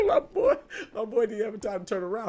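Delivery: high-pitched, helpless laughter; laughing. Real, not acted. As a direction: squeal with laughter while telling it. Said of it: "I'm laughing now".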